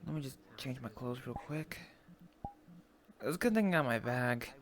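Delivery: usual speaking voice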